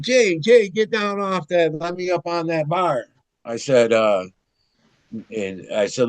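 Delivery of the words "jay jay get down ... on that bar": higher-pitched call to a friend; retold calling out. Calling to older friend on the jackhammer, retold call. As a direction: hail him urgently, acting it out.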